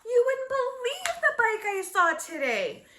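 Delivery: put-on high, bright-eyed burst of a little girl; eager girl can't wait to tell; excited exclaim. A narrator's reading. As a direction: blurt it out thrilled.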